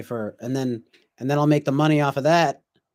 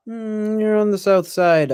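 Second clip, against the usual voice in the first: playful sing-song; 8 semitones higher, 5 dB louder, slower than usual. Retold or imitated. Playful quote, higher pitch.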